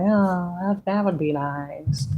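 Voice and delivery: high, slow dreamy wishing; mocking someone who only wishes; mock wistful sigh. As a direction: drag it out like a passive dreamer. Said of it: "to fucking be like".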